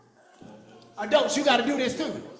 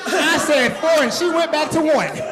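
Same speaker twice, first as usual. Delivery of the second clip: loud exasperated; 4 semitones higher, 5 dB louder than usual. Real, not acted. Kids miscounting, leader exasperated.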